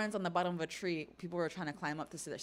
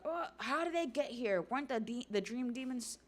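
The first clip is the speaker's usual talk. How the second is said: startled villain; 6 semitones higher than usual. Acted. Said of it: "Somers is like".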